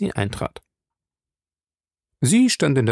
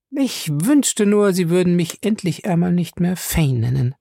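high, prim and a little mannered; slightly affected; 8 semitones higher than usual. Acted. Fay objects to her first name, slightly affected. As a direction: put on ladylike airs, pitched up. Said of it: "sagte sie etwas geziert".

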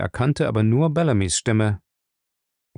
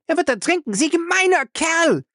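screaming. Acted. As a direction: cry it out in alarm. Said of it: "schrie Faye".

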